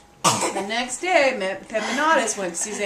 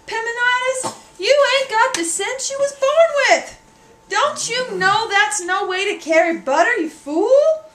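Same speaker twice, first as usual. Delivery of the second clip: high, loud exasperated scolding; mother scolding son for carrying butter in his hat, exasperated; scolding mammy; 10 semitones higher, 5 dB louder than usual. Acted. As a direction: scold the foolish boy at full pitch.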